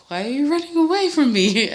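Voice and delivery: hammed-up outrage at the guests; acting out disbelief at friends fleeing; mock disbelief. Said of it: "And I'm like".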